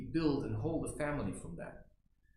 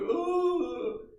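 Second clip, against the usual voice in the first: high loud overdone groan of grief; mock dramatic sob; 7 dB louder than usual. Mocking showy crying, a high loud mock sob. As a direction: ham up a theatrical sob. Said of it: "I don't have to be like".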